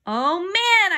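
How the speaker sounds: loud, high groan of protest; frustrated shout. A narrator's reading. Boy losing the quiz round, frustrated shout. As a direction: cry out your disappointment. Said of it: "I shouted".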